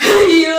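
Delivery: a loud wailing ayyo; wailing cry. Acted. Mother cries out after her daughter-in-law's insult, wailing. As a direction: cry out as if wounded.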